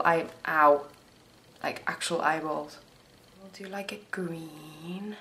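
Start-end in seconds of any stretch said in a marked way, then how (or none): 3.4-5.1 whispering. Real, not acted. Muttering while doing makeup.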